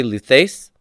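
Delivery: slow, deliberate chanting of the paradigm; teacher chanting endings for students to repeat; drill recitation. Shown as a demonstration. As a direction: lead the class through the forms aloud. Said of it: "So everybody".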